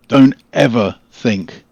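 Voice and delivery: "Don't ever think" slow and loud, each word set apart; slow, forceful "Don't ever think" with gaps between words; emphatic warning. Acted. Carl warns about the dangerous magic.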